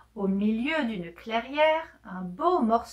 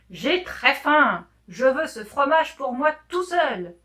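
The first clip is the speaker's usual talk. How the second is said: growled; 3 semitones higher, 6 dB louder than usual. A narrator's reading. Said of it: "gronda le tigre de droite". (grondement lent et fort)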